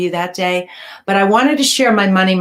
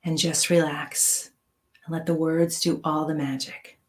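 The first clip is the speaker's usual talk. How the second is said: guided meditation; 3 semitones lower, 8 dB quieter than usual. Acted. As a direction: ease them into calm, quietly. Leads a meditation.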